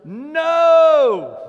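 a long, high shouted no; emphatic no. Real, not acted. Preacher answering his congregation with a huge high no. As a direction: hit it hard and high.

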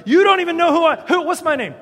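high, loud indignant rant at the guards; hammed-up indignant prisoner yelling; outraged protest. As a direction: demand your rights, furious. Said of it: "That would be more like me".

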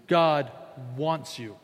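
usual speaking voice

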